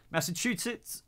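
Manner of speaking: "Massachusetts" as "Massa-choo-sit", rising high like a question; tentative pronunciation. Real, not acted. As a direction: try Massachusetts unsurely, ending in "-sit". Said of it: "I can't say it properly".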